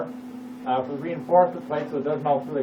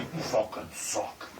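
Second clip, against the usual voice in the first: slow, low, whispered villain voice; evil voice; 6 semitones lower, 8 dB quieter, much slower than usual. Shown as a demonstration. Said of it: "I'll do an evil voice".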